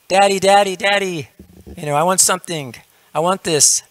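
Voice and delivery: high-pitched mock kid nagging; pestering child. Child begging daddy for something, high and insistent. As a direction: whine for attention like a small child.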